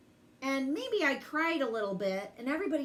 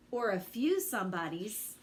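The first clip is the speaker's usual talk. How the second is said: plain reading voice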